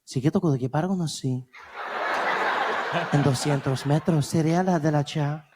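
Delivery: GPS guide in a put-on Spanish accent; sexy Spanish-accented navigation voice. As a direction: purr out directions in mock Spanish. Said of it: "gibberish in the accent".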